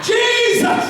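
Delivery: a high, loud cry of the name; holler loud. Shown as a demonstration. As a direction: holler Jesus! at the top of your voice. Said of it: "just holler loud".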